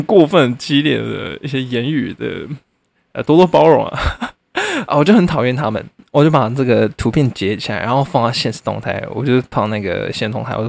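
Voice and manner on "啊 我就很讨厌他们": ordinary talk, nothing marked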